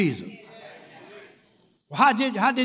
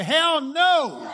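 loud, high defiant shout; raised-voice preacher's rejection; emphatic refusal; 7 semitones higher, 4 dB louder than usual. Acted. Preacher models emphatic refusal to hell.

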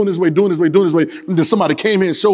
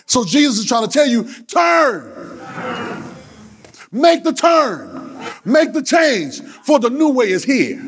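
high, drawn-out preacher's proclamation; full-force preaching; 7 semitones higher, slower than usual. Acted. Preacher urging congregation to make the turn, full-force preaching. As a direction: lift it high and stretch the call.

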